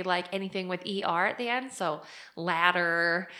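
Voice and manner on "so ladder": "ladder" said slowly with a flapped, r-coloured ending; "ladder" stretched out, ending in a clear -er; "ladder" said the Canadian way, not like an Australian; Canadian pronunciation. Shown as a demonstration. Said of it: "making fun of how we say".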